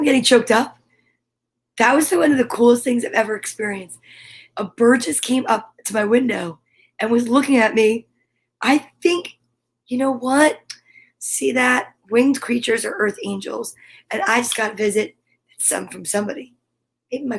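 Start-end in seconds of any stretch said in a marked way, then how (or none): none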